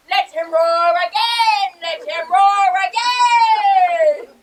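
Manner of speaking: slow, loud roaring shout. Acted. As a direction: roar it out for the crowd. Bottom shows off his roaring.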